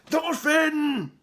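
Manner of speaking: loud barking call of a name; barked. A narrator's reading. Chief barks at the polite boy, loud bark. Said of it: "barked Eric the Earmasher".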